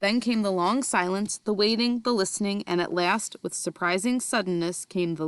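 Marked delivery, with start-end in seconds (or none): none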